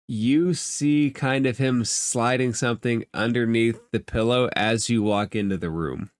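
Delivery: normal talking voice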